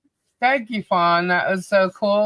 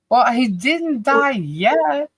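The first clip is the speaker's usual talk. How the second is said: mock drawl; 9 semitones higher, much slower than usual. Joking in put-on voice.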